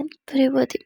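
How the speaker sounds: usual speaking voice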